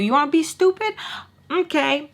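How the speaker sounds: high, challenging taunt; taunting threat. Retold or imitated. Girl threatening the boy back, taunting.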